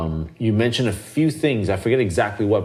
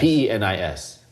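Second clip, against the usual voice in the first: usual speaking voice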